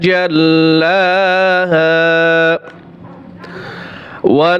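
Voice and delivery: slow melodic tilawat, each word drawn out; measured devotional chanting; Quran recitation. Acted. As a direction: chant the verse with long held vowels.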